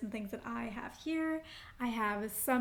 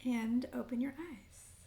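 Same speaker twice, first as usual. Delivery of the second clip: unhurried meditation guide with long pauses; guided meditation; 4 dB quieter than usual. Acted. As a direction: lead them gently into breath and intention, phrase by phrase. Leads grounding breaths and intention setting.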